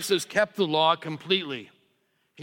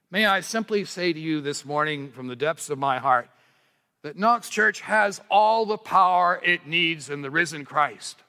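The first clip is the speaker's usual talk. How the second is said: ordinary talk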